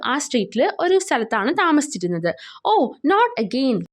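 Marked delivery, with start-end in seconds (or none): none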